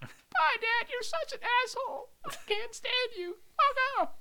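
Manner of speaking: very high squeaky farewell; tiny, high put-on voice; helium-voiced son. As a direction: play a hurt kid floating off on helium. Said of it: "sound like you've been inhaling helium".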